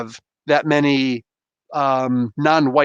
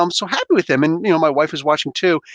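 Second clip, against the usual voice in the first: usual speaking voice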